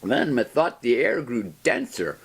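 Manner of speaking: plain reading voice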